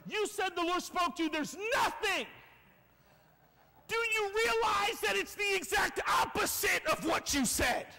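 high, rising to a shout; exasperated accusation. Acted. Servant confronting the prophet over nothing, outraged shout. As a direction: throw the promise back at them, outraged.

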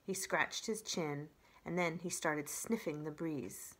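usual speaking voice

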